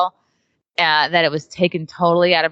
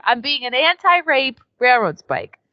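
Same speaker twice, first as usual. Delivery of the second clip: ordinary talk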